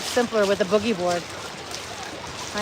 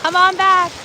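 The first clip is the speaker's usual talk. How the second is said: calling parent; 7 semitones higher than usual. Retold or imitated. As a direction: call the little one back to shore. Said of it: "The parents are like".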